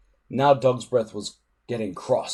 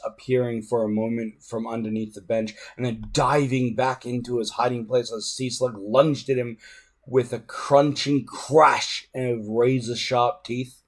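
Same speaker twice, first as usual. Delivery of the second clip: ordinary delivery, nothing marked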